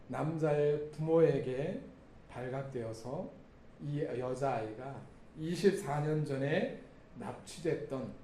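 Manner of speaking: ordinary talk, nothing marked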